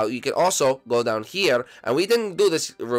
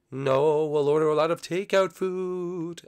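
ghost voice; 4 semitones higher, slower than usual. Acted. Blimpy answers about cooking.

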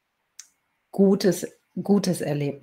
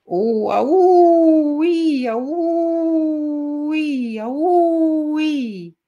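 long, high, wailing howls; wolf howling; 11 semitones higher, 6 dB louder, much slower than usual. A narrator's reading. Trapped wolf screams more than before, high howling. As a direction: howl loud and high, swelling like a wolf. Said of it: "fing wieder an zu schreien und zu heulen".

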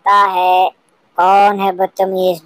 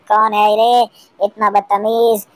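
ordinary talk

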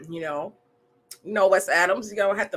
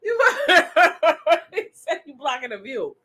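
laughing; 6 semitones higher, 5 dB louder than usual. Real, not acted. Laughing while repeating comment.

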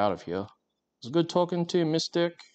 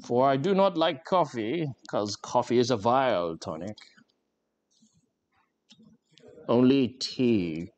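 posh voice; 3 semitones higher than usual. Shown as a demonstration. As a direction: put on the posh Australian accent. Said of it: "I have an extremely posh voice".

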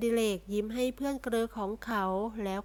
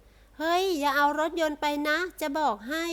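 high, loud yell from upstairs; loud shout; 6 semitones higher, 5 dB louder than usual. A narrator's reading. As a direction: shout down to stop them. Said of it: "ร้องตะโกนขึ้นด้วยเสียงอันดัง". (ตะโกนเสียงดังจากชั้นบน)

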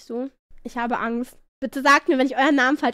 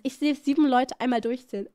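normal talking voice

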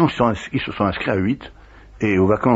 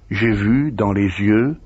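usual speaking voice

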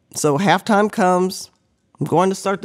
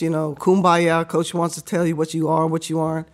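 ordinary delivery

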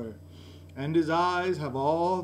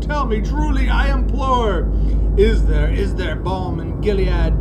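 desperate plea; 3 semitones higher, 11 dB louder than usual. Acted. Pleading with the raven.

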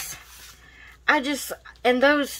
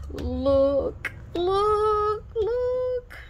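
rising higher with each look; climbing excitedly higher on every repeat; 10 semitones higher, much slower than usual. Real, not acted. Showing stickers excitedly.

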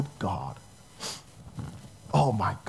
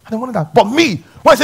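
ordinary delivery

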